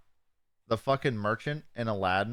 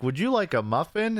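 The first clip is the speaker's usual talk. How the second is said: higher, chirpy offer; sweet little cartoon muffin; cheery muffin; 7 semitones higher than usual. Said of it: "He's like".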